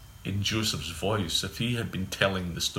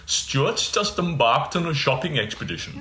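raised pitch, posh Anglo-Indian lilt; imitating father's posh Anglo-Indian voice; 5 semitones higher than usual. Son recalls father answering the door, posh Anglo-Indian accent. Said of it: "he had this kind of strange, kind of slightly Indian".

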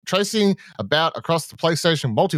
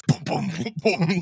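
booming heartbeat noise, higher pitched; imitated heartbeat; 6 semitones higher than usual. Co-host shows the heartbeat rattling his room, imitated booming. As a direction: mimic a thudding bass pulse. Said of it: "this heartbeat playing through it".

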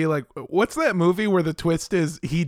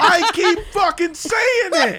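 loud laughing outburst, much higher; 11 semitones higher, 4 dB louder than usual. Real, not acted. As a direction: burst out laughing as you say it. Laughing at own repeated phrase.

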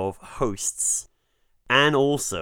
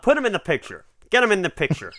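high, punchy 1930s Hollywood mogul patter; old Hollywood producer; 8 semitones higher, faster than usual. Pretend. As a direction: bark it like a golden-age studio boss. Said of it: "it's the golden era of Hollywood".

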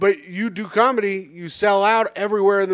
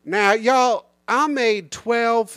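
put-on slow Madea-style Southern accent; 3 semitones higher, much slower than usual. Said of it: "Do one of your jokes in a Southern accent".

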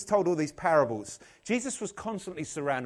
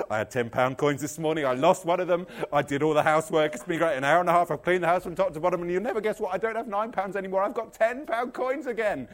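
loud, gushing good news; excited; 6 dB louder than usual. Acted. Woman telling friends she found her coin, excited. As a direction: rattle off the triumph with mounting excitement. Woman's breathless phone story.

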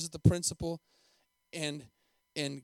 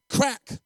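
loud, high voiced whip crack; belt crack; 8 semitones higher, 9 dB louder than usual. A narrator's reading. Preacher narrates the beating, loud sharp crack.

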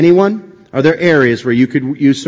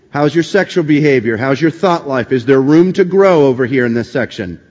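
normal talking voice

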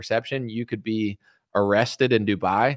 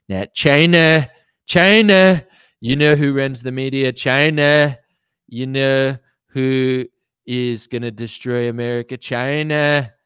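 Trump shouting China; 3 semitones higher, 5 dB louder, slower than usual. Retold or imitated. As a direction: hammer China like a rally crowd-pleaser. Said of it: "me, Donald J Trump".

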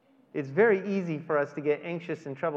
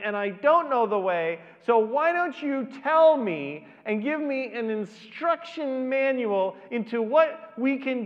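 higher, louder, frustrated disciple demanding instructions; exasperated Thomas; 6 semitones higher, 8 dB louder than usual. Retold or imitated. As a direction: throw up your hands as Thomas, louder and higher. Thomas voiced, exasperated with Jesus.

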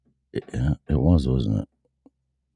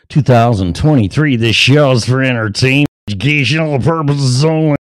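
higher, sing-out radio disclaimer; announcer disclaimer; 8 semitones higher, 5 dB louder than usual. Real, not acted. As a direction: rattle off the legal notice in a raised announcer voice. Rattles off show disclaimer.

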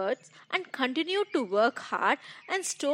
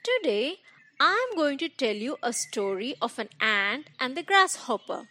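usual speaking voice

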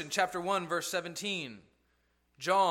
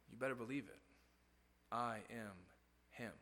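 low, much softer, firm confident claim; quiet intensity; 6 semitones lower, 12 dB quieter than usual. Acted. Jesus tells his enemies who he is, quiet and firm. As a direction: say it softly but with total certainty.

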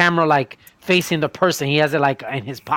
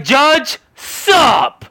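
high, louder mock sup to the judge; cheeky lawyer; 9 semitones higher, 6 dB louder than usual. Lawyer greeting the judge casually, high and loud. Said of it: "be like".

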